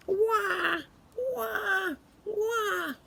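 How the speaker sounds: high wailing wah wah; mock baby crying. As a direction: wail like a small baby. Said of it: "A baby crying".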